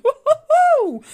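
high, loud triumphant woo-hoo; excited crowing whoop; whoop of delight. Real, not acted. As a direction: let out a long, high celebratory whoop. Celebrates card repeating her words.

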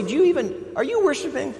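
high, sly, needling accuser; lightly voiced mocking accuser; accuser's voice. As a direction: taunt like the accuser planting doubt. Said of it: "the accuser will come along and say".

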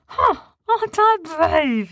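slow, high, self-satisfied; proud. A narrator's reading. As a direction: boast to friends. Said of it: "he said".

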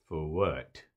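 low, soft, slow hostile voice; a suspicious Klansman at the door, menacing and quiet; man behind the door sizing up a stranger, low and slow; mock Klan leader. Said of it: "imaginative rendition".

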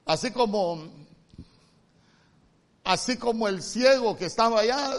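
ordinary delivery

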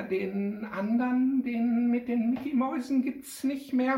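put-on high, halting child's question; dismayed little girl, high voice; stammering. A narrator's reading. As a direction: plead haltingly, pitched well up. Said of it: "stammelte Pia".

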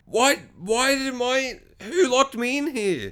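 high, slow stammering outrage; mock indignant. Dad just freed from closet, spluttering mock indignation.

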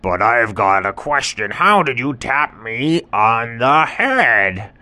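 slow, loud humbled tiger; sheepish tiger. Acted. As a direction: admit it slowly. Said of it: "And the tiger said".

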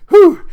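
loud high "whew"; sharp exclaimed whew at a zinger; wowed whoop. Real, not acted. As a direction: let out an impressed high whew. Reacts to the line's irony.

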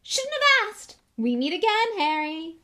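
high, booming, hearty greeting; loud self-delighted celebrity; thundering jovially. Acted. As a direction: boom it out with showy good cheer. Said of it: "thundered jovially".

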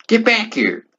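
very high-pitched order; calling back. Acted. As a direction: call them back in a high voice.

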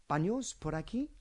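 emphatic question rise on "aquí"; demonstrated question inflection. Shown as a demonstration. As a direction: swing "aquí" clearly upward at the end. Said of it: "if you notice the inflection here".

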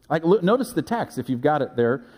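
ordinary talk

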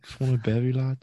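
a light high Scouse "Just want a bevy, lad"; Scouse impression. Co-host echoes the fighter's line in mild Scouse. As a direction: repeat it again in a lighter Liverpool accent. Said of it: "First thing comes to her mind".

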